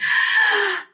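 a high, audible gasping inhale; a sharp gasp of air, shown to the viewers; deep breath in. Shown as a demonstration. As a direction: demo a big breath in. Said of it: "took a deep breath in".

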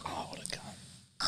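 hushed disgusted whisper; whispered swear. Real, not acted. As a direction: mutter it under your breath. Reaction whispered.

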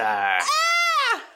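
startled shriek. Real, not acted. Frightened shout.

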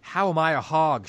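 loud, high indignant demand; a loud affronted challenge. Acted. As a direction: demand an answer, offended. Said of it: "she demanded".

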